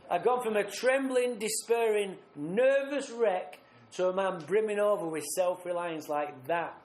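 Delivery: ordinary delivery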